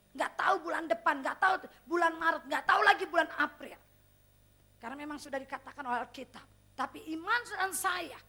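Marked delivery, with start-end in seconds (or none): 0.1-3.8 rising preaching climax. Real, not acted.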